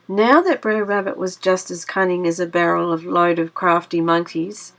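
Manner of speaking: ordinary delivery, nothing marked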